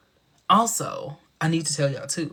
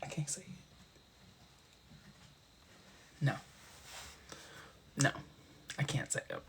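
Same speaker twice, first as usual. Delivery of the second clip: embarrassed hush; 2 semitones lower, 18 dB quieter, faster than usual. Real, not acted. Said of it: "I'm too embarrassed".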